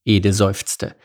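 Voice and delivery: ordinary delivery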